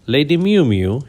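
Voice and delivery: lightly voiced tomcat purring theatrically; dramatic purr. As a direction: purr her name with flair. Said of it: "he purred dramatically".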